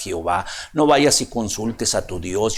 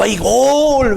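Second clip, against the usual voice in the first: goal shout; 10 semitones higher, 4 dB louder than usual. Retold or imitated.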